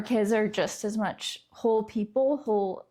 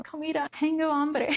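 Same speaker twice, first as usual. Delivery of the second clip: lightly acted struggling foreigner, higher pitch; frustrated visitor; 6 semitones higher than usual. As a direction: plead for food in halting frustration. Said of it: "they're feeling frustrated".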